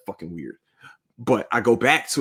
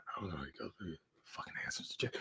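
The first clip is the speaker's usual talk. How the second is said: unvoiced aside, almost no sound; hushed whisper; 4 semitones lower, 20 dB quieter, faster than usual. Real, not acted. Host whispering about the ghost in the room. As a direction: whisper it like a secret.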